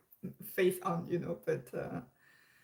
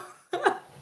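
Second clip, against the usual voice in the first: laugh; 3 dB louder than usual. Real, not acted. Laughing at being called reactor.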